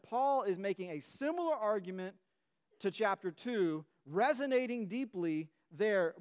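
normal talking voice

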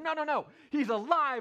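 loud, high, urgent protest; excited insistence. Acted. Mary arguing with disbelieving disciples, excitedly insistent.

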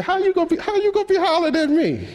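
sassy woman. Pretend. Woman at the well brushing off Jesus, high sassy voice. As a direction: push back like a sassy woman. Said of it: "She said".

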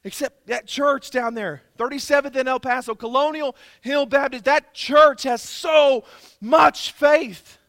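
slow, building to a full shout; Jesus praising the church. Pretend. Jesus pointing out the El Paso church, slow then shouting. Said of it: "and say".

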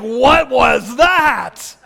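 slow, drawn-out bewildered question; slow, stunned puzzlement; startled. Retold or imitated. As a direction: sound stunned by the thunder. Crowd startled by thunder.

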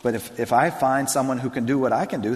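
ordinary talk, nothing marked

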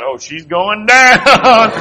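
slow, drawn-out chuckle of glee; laughing slowly. Retold or imitated. Preacher recalling the woman wobbling, slow laughing. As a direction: savour it through a laugh.

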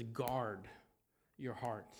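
quiet, weighty reminder; hushed verse. Acted. Preacher repeats verse, hushed and low.

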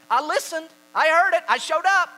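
high, shouted self-defence; mock-indignant, loud, separate bursts; insistent protest. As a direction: shout each claim as proof. Voices self-righteous hearer protesting.

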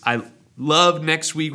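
normal talking voice

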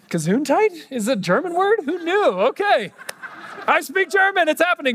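high-pitched, hammed-up excitement; mock amazement. Preacher joking he suddenly speaks German, mock amazed. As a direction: squeal it like a pretend sudden miracle.

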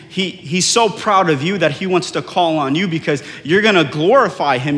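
usual speaking voice

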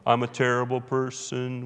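slow, droning mock unworthiness; hammed-up "poor sinner" moaning; mock self-abasement. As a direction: drag it out in a woeful monotone. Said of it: "That doesn't mean we live in this".

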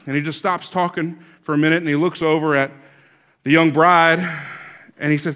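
ordinary talk

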